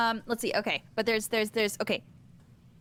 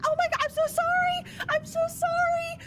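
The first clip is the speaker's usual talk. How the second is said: very high, frantic apology; panicked. Acted. Druid apologizing to the man she maimed, panicking. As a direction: squeal the apology in acted distress. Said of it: "And she's just panicking".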